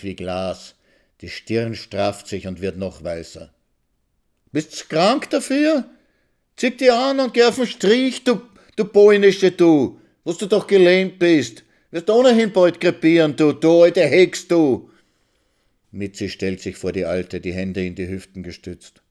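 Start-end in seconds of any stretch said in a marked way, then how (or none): 4.5-14.9 screeching very high and loud; shrieking. Acted. Young wife screeching insults at the crippled old woman. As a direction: scream the insult at her.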